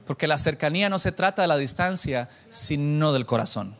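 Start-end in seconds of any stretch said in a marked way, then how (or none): none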